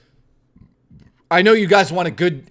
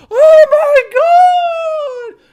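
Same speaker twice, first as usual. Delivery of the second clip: drawn-out ecstatic shriek, much higher and louder; crowd of girls squealing; 9 dB louder, much slower than usual. Retold or imitated. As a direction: squeal like twenty thrilled fans at once. Twenty girls squeal in chorus.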